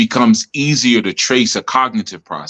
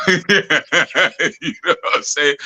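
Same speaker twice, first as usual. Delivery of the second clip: slow, breaking into laughter mid-question; laughing; 6 semitones higher, much slower than usual. Real, not acted. Laughing while telling a friend about childhood teasing. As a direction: laugh through it while asking.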